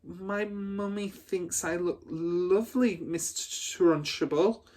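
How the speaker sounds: put-on a frightened girl defending her mother; timid stutter. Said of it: "Amanda stuttered".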